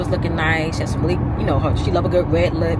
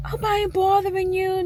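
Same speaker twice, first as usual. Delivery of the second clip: imitating auntie; 12 semitones higher, 6 dB quieter than usual. Aunt's voice on phone.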